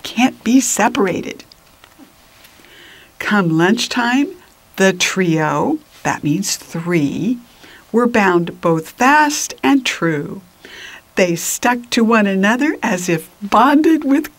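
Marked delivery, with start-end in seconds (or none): none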